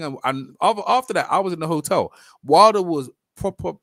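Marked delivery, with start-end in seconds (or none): none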